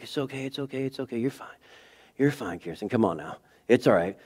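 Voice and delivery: put-on soft, hushed comforting to a hurt toddler; soothing a child. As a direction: murmur it into her ear to calm her. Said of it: "I'm like".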